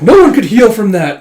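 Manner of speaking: loud, high-pitched protest; horrified disbelief. Acted. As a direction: shout it in shocked denial. Soldier panics at healing.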